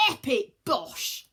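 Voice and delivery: slow, loud, hammed yell. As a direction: whoop it while jumping in. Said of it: "yelled Hermes".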